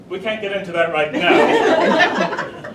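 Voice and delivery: slow, loud words through laughter; laughing. Real, not acted. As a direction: barely hold it together. Teller laughing through the aside.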